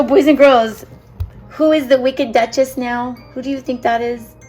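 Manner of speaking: ordinary talk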